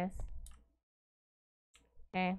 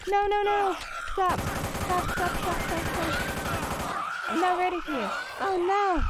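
high frantic terrified stop stop; panicked pleading; 8 semitones higher, 5 dB louder, slower than usual. Real, not acted. Monster attacks, player panics.

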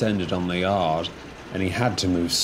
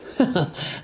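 nervous chuckle; 10 semitones higher than usual. Acted. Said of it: "chuckled Diesel".

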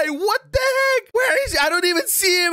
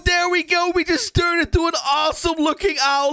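ordinary talk

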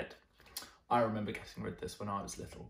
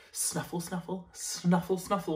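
higher, snuffly sniffing; hedgehog snuffle; 5 semitones higher than usual. Acted. The hedgehog in the garden, higher snuffles.

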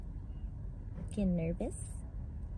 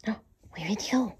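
whispering; 3 semitones higher, 5 dB quieter, faster than usual. Real, not acted. Whisper playing with puppy.

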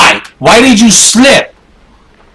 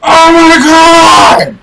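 higher, slow drawn-out cry; exasperated shout; 6 semitones higher, 4 dB louder, much slower than usual. Real, not acted. Player raging at his clumsy character, drawn-out shout. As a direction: stretch it out in disbelief. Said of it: "give me twenty minutes of me fucking raging".

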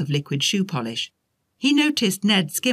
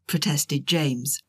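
ordinary delivery, nothing marked

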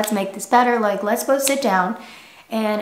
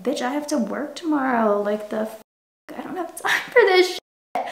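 exasperated rant; 4 semitones higher than usual. Retold or imitated. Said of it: "and it's like".

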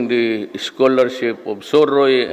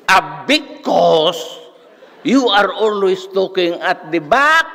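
mock angry professor; 9 semitones higher than usual. Professor scolding student who stood up, high mock anger. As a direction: bark it like a furious old professor. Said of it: "sabi niya".

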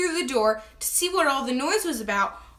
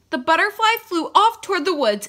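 ordinary delivery

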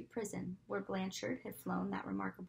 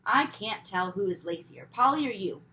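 mother scolding; 8 dB louder than usual. A narrator's reading. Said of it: "Mother muttered".

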